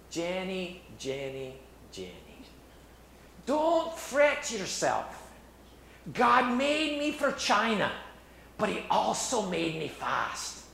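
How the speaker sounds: imitating a Scottish brogue, slow and lifted, with a laugh on pleasure; Scottish accent. Eric reassuring his sister Jenny, in a Scottish accent. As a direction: retell Eric's words in a lightly put-on Scottish accent. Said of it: "I say that in that Scottish accent".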